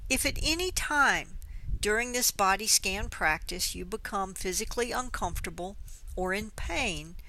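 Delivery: ordinary talk, nothing marked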